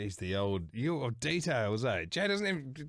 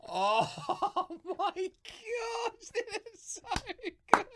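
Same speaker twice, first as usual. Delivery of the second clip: very high, drawn-out laughing squeal; helpless laughter; much slower than usual. Real, not acted. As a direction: squeal it through real laughter. Listener cracks up at the story.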